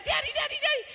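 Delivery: put-on very high, gleeful child chant; excited kids. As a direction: call out like a happy little girl. Kids greet dad excitedly.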